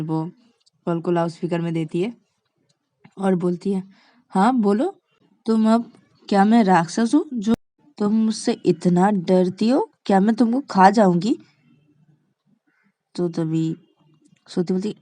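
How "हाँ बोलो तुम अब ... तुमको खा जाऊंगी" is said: loud, slightly raised questioning; mock challenge. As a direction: confront her for the listeners' benefit. Shreya confronts Shruti on speakerphone.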